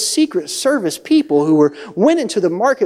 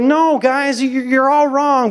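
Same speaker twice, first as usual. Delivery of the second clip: protesting spies; 8 semitones higher, slower than usual. Acted. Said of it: "Caleb and Joshua are like".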